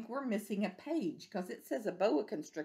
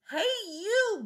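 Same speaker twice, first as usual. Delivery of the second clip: much higher bossy hail; calling out; 14 semitones higher than usual. Acted. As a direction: call out for a fight. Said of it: "said the grouchy ladybug".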